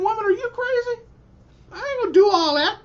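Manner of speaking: retelling his younger self's high, fast disbelief; incredulous outburst. Retold or imitated. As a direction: fire it back like she lost her mind. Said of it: "I remember my explosion".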